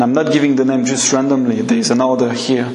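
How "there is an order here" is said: ordinary talk, nothing marked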